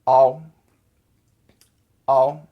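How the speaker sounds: "ow" as a loud, level vowel glide; "ow" said deliberately on an even tone; Chinese AO sound. Shown as a demonstration. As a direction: hold "ow" out clearly as one sound. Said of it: "the A O sound in Chinese is".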